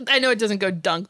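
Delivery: ordinary talk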